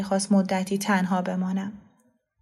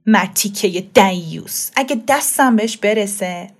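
slow, loud furious insult; loud, slow enraged threat; angry man; 4 dB louder than usual. Acted. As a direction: spit the curse out slowly in rage. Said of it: "با عصبانیت گفت".

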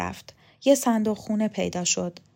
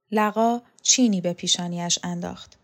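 plain reading voice

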